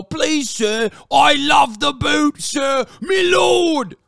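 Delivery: slow, loud, put-on servile voice; mocking grovelling peasant. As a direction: ham up a begging serf. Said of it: "peasants like yourself with peasant brains go".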